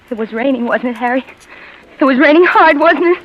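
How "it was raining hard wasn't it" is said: rising panic. Acted. Maud breaking down over the rain.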